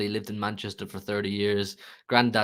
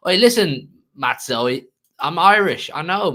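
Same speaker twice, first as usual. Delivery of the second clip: 1 loud call-out; 6 semitones higher, 7 dB louder, slower than usual. Real, not acted. Calling out to a chat viewer.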